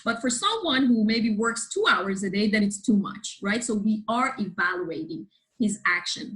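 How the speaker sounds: usual speaking voice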